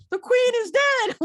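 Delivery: high, loud shrieking proclamation; Monty Python shout. Retold or imitated. Retelling the woman's street announcement, loud Monty Python shriek. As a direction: shriek it like a Python sketch lady. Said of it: "Literally, that was her voice".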